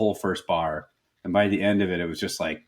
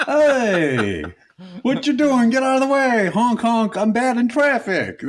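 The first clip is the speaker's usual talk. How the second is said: loud, higher mock New York driver; New York accent; 13 semitones higher, 5 dB louder, slower than usual. A New York driver yelling in traffic, mock New York accent. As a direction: honk at traffic like a cartoon New Yorker. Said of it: "my classic New York material".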